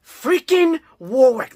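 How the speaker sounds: hyped reveal. Real, not acted. Hyped about Warwick debut.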